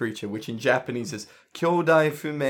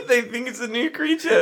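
laughing; 12 semitones higher than usual. Real, not acted. Laughing while retelling.

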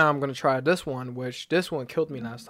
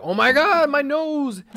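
mock agony; 12 semitones higher, 7 dB louder, slower than usual. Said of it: "it's not like".

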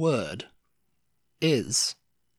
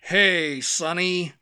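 put-on higher-pitched caricature Chicago gangster accent; 3 semitones higher, 5 dB louder, slower than usual. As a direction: imitate a bad movie Chicago gangster. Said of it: "the Chicago gangster".

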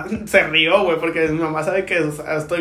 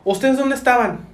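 higher-pitched telling-off; mock scolding; 6 semitones higher than usual. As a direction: demand it like an authority imitating a scolding. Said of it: "Y los regañó".

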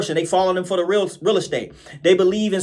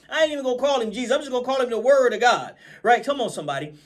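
ordinary delivery

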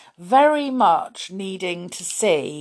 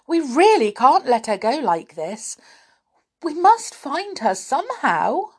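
higher and louder, frightened; anxious pleading; 8 semitones higher, 5 dB louder than usual. Acted. Beth worrying about lost Connie, anxious. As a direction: beg the others for a plan. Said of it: "Beth went pale".

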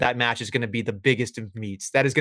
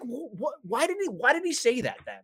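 baffled; 11 semitones higher, 5 dB quieter than usual. Retold or imitated. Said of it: "I was just like".

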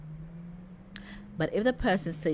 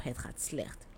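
softer, slow, downcast; sad reply; 3 semitones lower, 10 dB quieter than usual. Shown as a demonstration. Someone not doing well answers softly and sadly. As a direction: let it sink, slow and soft.

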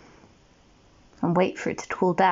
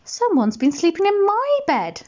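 high mummy bear voice; shocked high mummy bear; 11 semitones higher than usual. A narrator's reading. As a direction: lift it up high for the mother bear. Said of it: "said Mummy Bear".

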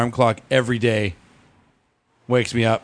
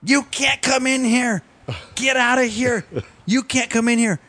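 imitating yelling girls; 9 semitones higher than usual. Father imitating little twins yelling at his son, higher and bossy. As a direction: mimic two bossy four-year-olds shutting him out. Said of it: "they both start yelling at him".

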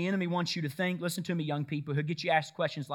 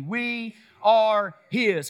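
very slow, loud, each word held out; call-and-response lead; 3 semitones higher, 5 dB louder, much slower than usual. Shown as a demonstration. Preacher leading congregation to say it along, drawn out. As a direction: stretch every word so a crowd can join. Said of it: "Say it with me".

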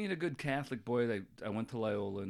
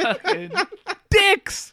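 loud, high, laughing insult; laughing curse; 14 dB louder, much slower than usual. Real, not acted. Podcast host laughs loudly at friends teasing him. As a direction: blurt it through laughter.

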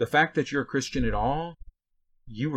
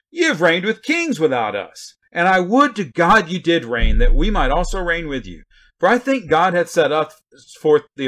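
sarcastic; 5 semitones higher, 10 dB louder than usual. Acted. Preacher reading Paul's rebuke to the puffed-up church, sarcastically. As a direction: read it dripping with sarcasm, big swooping stresses. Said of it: "I'm gonna read it with that tone".

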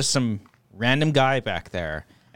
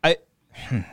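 ordinary delivery

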